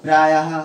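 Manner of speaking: teacher sounding out visarga ha; visarga demo. Shown as a demonstration. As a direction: end प्रायः with a clear breathy ha. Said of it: "हा का उच्चारण आएगा बाद में".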